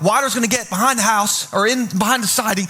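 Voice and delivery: usual speaking voice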